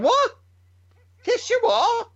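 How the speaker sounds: very high, loud baffled questioning; incredulous. Retold or imitated. Dad baffled by his son's slang, incredulous. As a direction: query it in squeaky disbelief.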